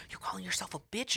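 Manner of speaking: soft, breathless whisper; whispered question. Retold or imitated. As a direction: whisper it teasingly. Man quoted, whispered.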